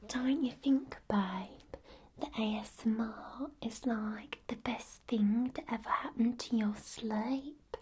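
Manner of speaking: put-on Essex accent. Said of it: "when I do the Essex accent".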